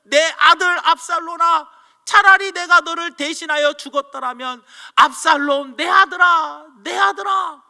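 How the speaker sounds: crying out a grieving father's lament, loud and high; a father wailing for his dead son, voice rising to a shout; anguished father's cry. Acted. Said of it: "그가 올라갈 때 말하기를". (죽은 아들을 부르며 울부짖는 아버지의 외침)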